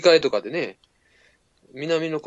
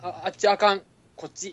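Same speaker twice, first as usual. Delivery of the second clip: lightly voiced blunt directions in Kansai dialect; a casual Kansai reply, pointing the way; 6 semitones higher than usual. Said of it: "日本語やな、思いっきり". (関西弁で気軽に道案内)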